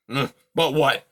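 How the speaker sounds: put-on loud grumpy old creature voice; gruff old porcupine. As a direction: grumble like a cranky old porcupine. Aerith speaking.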